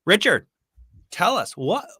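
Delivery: ordinary talk, nothing marked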